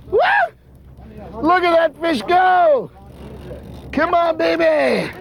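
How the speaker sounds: high drawn-out thrilled shouting; excited shout. Real, not acted. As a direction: cheer the fish on. Fish pulling the line hard.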